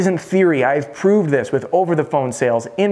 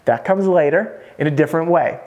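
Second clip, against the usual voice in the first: usual speaking voice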